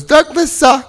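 high, slurred mock-drunk "Douglas, sir"; lightly hammed drunk voice; tipsy wife. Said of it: "She goes".

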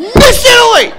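very high, loud mock squeal; hammed-up delighted recognition. Captain recognising the woman from the plane, hammed-up delight. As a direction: shriek the name in exaggerated delight.

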